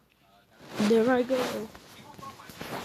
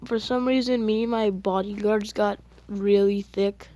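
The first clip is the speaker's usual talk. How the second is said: usual speaking voice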